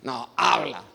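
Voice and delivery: low, submissive "habla"; total obedience. Shown as a demonstration. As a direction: say "habla" low, as full surrender. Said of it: "Obediencia total". («habla» grave, de obediencia total)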